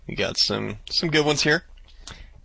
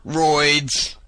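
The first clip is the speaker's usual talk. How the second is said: punched, drawn-out name; big, higher-pitched shout-out; loud exclamation; 4 semitones higher, 3 dB louder than usual. Shown as a demonstration. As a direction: call it out with exclamation marks. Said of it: "like, really loud".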